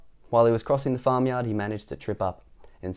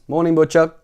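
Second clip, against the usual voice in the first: bright cheery greeting, higher and louder; cheerily; 8 semitones higher, 9 dB louder than usual. A narrator's reading. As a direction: greet the butcher with a cheerful lift. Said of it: "he said cheerily".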